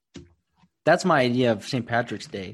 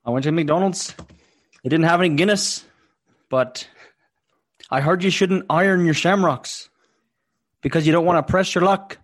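hammed-up Irish accent, pitched higher and louder; poor Irish accent; 7 semitones higher, 5 dB louder than usual. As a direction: play a jolly stage Irishman. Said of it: "our poor attempt at an Irish accent".

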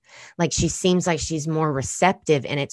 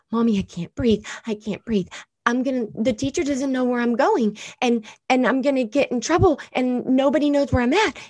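put-on high, breathless little girl in panic; panicked child voice; 9 semitones higher than usual. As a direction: voice a scared seven-year-old gasping.

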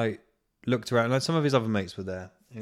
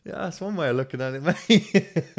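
high laughing reply; 9 semitones higher, 7 dB louder than usual. Retold or imitated. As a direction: answer while cracking up.

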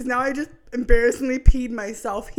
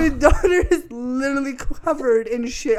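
exasperated scolding; 3 semitones higher, 6 dB louder than usual. Retold or imitated. Wife setting husband's priorities straight, exasperated. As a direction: scold with raised voice, half amused.

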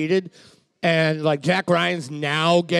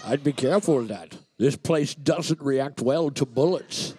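put-on Russian movie accent; 2 semitones lower, 3 dB quieter than usual. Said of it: "Russian man".

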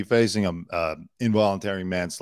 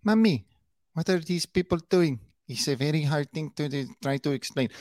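high mock Spanish-accented voice; hammed-up fake Spanish accent, lilting high; [name]'s fake Spanish accent; 6 semitones higher than usual. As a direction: mimic a put-on Spanish accent, pitched up. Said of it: "But God, that accent".

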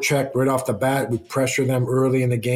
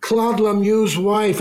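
imitating wife; 9 semitones higher, slower than usual. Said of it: "She said".

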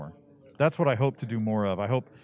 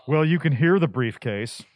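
slow, louder stuffy teacher; stuffy voice coach; 3 semitones higher, 5 dB louder, slower than usual. Retold or imitated. Voice coach judging a student before the class, slow and stuffy. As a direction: deliver a verdict slowly as a stuffy coach. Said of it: "a very stuffy voice coach".

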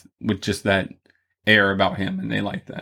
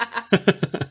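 high laugh; 11 semitones higher than usual. Real, not acted. Laughs at the cicada joke.

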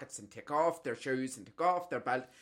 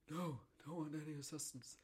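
imitating granda; 3 semitones higher, 12 dB quieter than usual. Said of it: "I was like".

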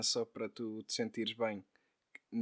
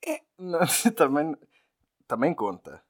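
laughing; 5 semitones higher, 11 dB louder than usual. Real, not acted. Laughs mid-line.

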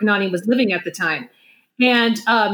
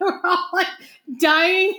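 normal talking voice

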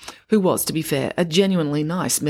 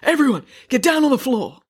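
raspy robber's command, pitched up; yelled; 9 semitones higher, 4 dB louder than usual. A narrator's reading. Robber to everyone in the bank, yelling. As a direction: order the whole room about. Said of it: "yelled the mud-covered person in a deep, raspy voice".

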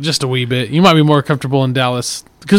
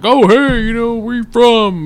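chirpy stranger; 8 semitones higher, slower than usual. Retold or imitated. Imagined stranger asking origin.

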